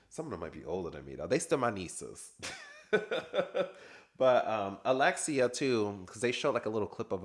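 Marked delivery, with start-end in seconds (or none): 2.4-4.0 a high, bright burst of laughter; real high-pitched laugh. Real, not acted. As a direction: laugh at your own joke. Laughing at own joke.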